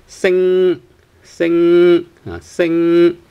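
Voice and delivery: "sing" high and held on the ng, no g; high, sustained "sing" ending in pure ng; no final g. Shown as a demonstration. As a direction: hum out the end of "sing" without a hard g. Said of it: "no G sound towards the end".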